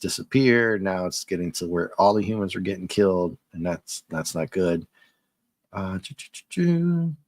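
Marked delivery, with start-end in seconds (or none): 5.7-7.2 high playful choo choo; sing-song train noise. Pretend. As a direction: toot like a toy train. Imitating train sound.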